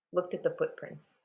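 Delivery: usual speaking voice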